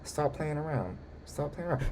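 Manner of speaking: soft, bored dismissal; unbothered wife. Retold or imitated. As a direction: brush him off without getting up. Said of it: "his wife is sitting there like".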